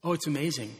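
normal talking voice

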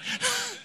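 a quick high laugh; brief real chuckle; short laugh. Real, not acted. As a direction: laugh at your own punchline. Laughs after his joke.

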